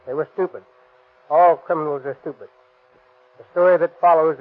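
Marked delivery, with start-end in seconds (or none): none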